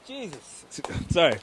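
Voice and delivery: ordinary delivery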